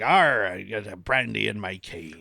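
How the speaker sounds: put-on low, slow growling pirate accent; pirate accent, bragging about his cane. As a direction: arr it out like an old sea pirate. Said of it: "Or it could be a pirate accent".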